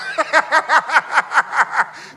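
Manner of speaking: high burst of laughter; real laughter; loud laugh. Real, not acted. Laughs at own joke.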